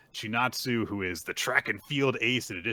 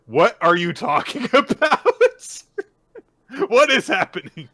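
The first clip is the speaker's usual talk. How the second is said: higher, louder baffled protest; bewildered villain; 14 semitones higher, 11 dB louder than usual. Retold or imitated. Code lost as Boruto explains the divine tree, loud baffled. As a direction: demand answers, lost. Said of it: "Code's like".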